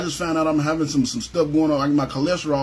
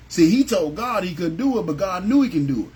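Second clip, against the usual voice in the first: normal talking voice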